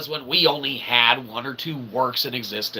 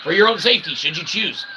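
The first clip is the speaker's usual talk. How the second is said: ordinary talk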